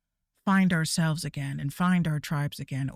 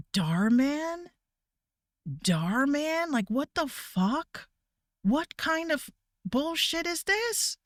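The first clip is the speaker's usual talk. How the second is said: higher, slow, incredulous; mock disbelief; 8 semitones higher, slower than usual. Streamer reacts to her kids' videos, higher and disbelieving. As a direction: act baffled by the video. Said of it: "And I'm just like".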